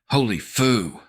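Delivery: high, loud gasp of horror; horrified exclamation. A narrator's reading. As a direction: blurt it out, stunned. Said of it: "Jackson muttered".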